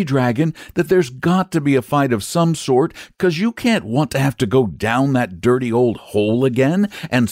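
put-on high-pitched boy pressing his point; earnest boy. Boy urges the dragon to accept a fight, earnestly.

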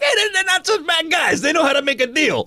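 hammed-up president calling out in a high voice; mock president. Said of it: "my president seems to be like".

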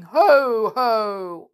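loud, high, drawn-out ho-ho; wizard's catchphrase. Acted. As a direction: mimic a horrid wizard's booming call. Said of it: "walks about saying".

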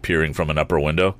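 ordinary delivery, nothing marked

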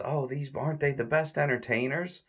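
high, gushing hostess voice; gushing society lady. Retold or imitated. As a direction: coo it like a delighted society lady. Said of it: "one of the guests says".

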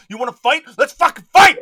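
high, very loud mock fight call, building; belligerent challenge. Cricket to the person coming at it, belligerent shout. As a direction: scream it like an overexcited brawler.

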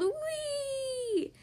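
long, high-pitched joyful squeal; gleeful yell. A narrator's reading. Grandma atop a water slide, long gleeful squeal. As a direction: stretch it out high like going down a slide. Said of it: "Grandma threw up her hands and yelled".

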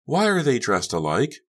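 high, wondering question; high-pitched puzzled gossip; curious bystander. A narrator's reading. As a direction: ask as a nosy party guest. Gossiping bystander voiced by narrator.

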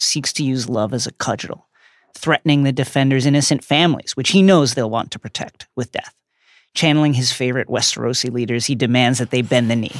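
ordinary talk

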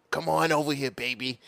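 lightly put-on seductive voice, pitched low; Judge Judy. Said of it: "why you gotta do that voice".

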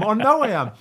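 high-pitched mock protest; high, exasperated comeback in an acted row; retold angry retort. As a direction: insist indignantly, hammed up for the story. Acts his fuming answer back at coach.

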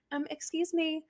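quiet, timid "excuse me"; soft polite excuse me. Retold or imitated. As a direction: say "excuse me" softly, barely heard. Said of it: "We'll just be like".

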